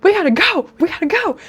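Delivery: frantic excited friend; excited squeal. Retold or imitated. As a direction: squeal it like you can't wait. Friend's excited call retold.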